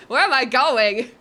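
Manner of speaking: loud alarmed cry; panicked outburst. Real, not acted. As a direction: blurt it out in panic. Panicked climbing, loud repeat.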